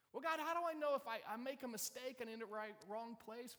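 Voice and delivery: high, loud, worried pleading; fretting questioner. Acted. Abram worrying about a wrong place, high and agitated.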